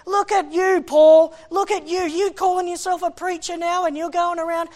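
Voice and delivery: high, loud taunting accusation; accusing devil. Acted. Devil taunting Paul about preaching, loud high accusation. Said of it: "when the devil's going".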